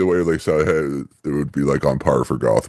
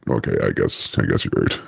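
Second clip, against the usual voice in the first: grudging concession; 7 semitones lower than usual. Acted. Batman conceding to his butler, low grudging mumble. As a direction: give in sheepishly, voice dropping away.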